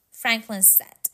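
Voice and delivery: usual speaking voice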